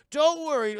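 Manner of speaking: high, loud carefree chauffeur; breezy reassurance. Retold or imitated. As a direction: loudly wave away the boss's worry. Driver reassures his angry boss.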